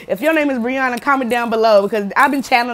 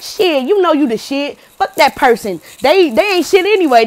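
louder and higher, rising into a fired-up rant; aggressive pep talk; 5 semitones higher, 5 dB louder than usual. Retold or imitated. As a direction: hype them up, get worked up about it.

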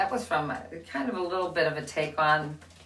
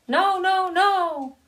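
squeaky high no no no; high, loud, chicks shutting him down; chicks insisting no; 13 semitones higher, 7 dB louder, much slower than usual. Acted. The chicks telling the duckling, high loud no.

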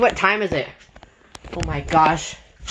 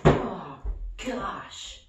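voiceless, much softer; exhausted whisper; 3 semitones lower, 9 dB quieter, slower than usual. Acted. User giving up on the smart speaker. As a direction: breathe it out spent.

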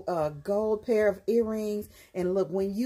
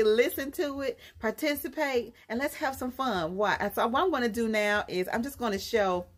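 ordinary talk, nothing marked

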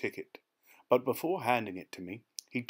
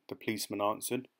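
ordinary delivery

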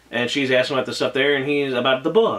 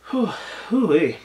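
whew; 5 semitones higher than usual. Real, not acted. Reacting to rain scene.

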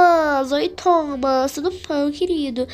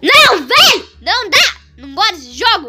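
frustrated yell; 6 semitones higher, 8 dB louder than usual. Real, not acted. Falls again in the game.

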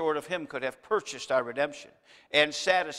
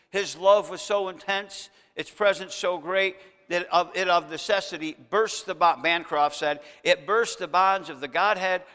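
louder preaching voice; rising preaching; 3 semitones higher, 5 dB louder than usual. Acted. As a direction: build it up for the congregation. Preacher rises again.

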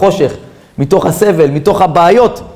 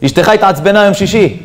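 usual speaking voice

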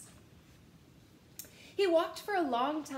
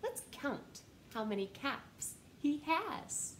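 plain reading voice

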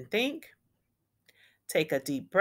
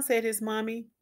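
ordinary delivery

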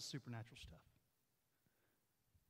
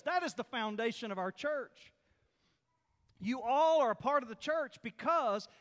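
raised, emphatic sermon voice; full-force preaching; 9 semitones higher, 10 dB louder than usual. Real, not acted. Preacher teaching the congregation about the called-out church, forceful and emphatic. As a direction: preach it out, punching the key words.

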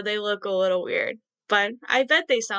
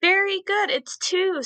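bright praise; 8 semitones higher than usual. Real, not acted. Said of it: "praising children".

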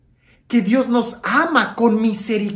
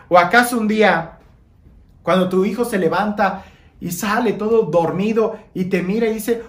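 ordinary talk, nothing marked

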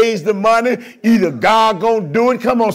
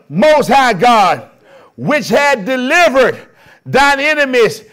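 rousing pulpit preaching; 5 semitones higher, 5 dB louder than usual. Acted. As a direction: preach it out to the whole room. Preacher proclaiming to congregation.

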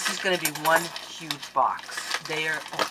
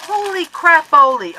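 much higher, loud exclamation; amazed; 11 semitones higher, 15 dB louder than usual. Real, not acted. Vlogger amazed by a generous package, loud and high. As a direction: shout in happy shock.

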